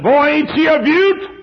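high drawn-out brag; bragging friend. Retold or imitated. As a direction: show off the beauty of it. Friend brags on his junk car.

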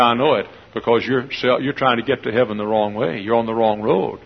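usual speaking voice

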